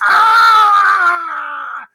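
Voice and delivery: long, high, loud scream; mock terrified scream. Macbeth seeing the ghost still there, long hammed-up scream. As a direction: scream the ghost panic over the top. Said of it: "a very public freak out".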